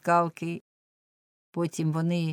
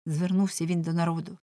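ordinary delivery, nothing marked

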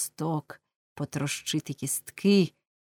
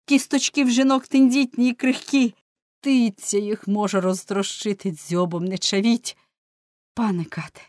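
loud, higher begging; desperate plea; 4 semitones higher, 6 dB louder than usual. A narrator's reading. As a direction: beg for his mother's bones. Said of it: "скрикнув він". (син благає суддів: гучно, відчайдушно)

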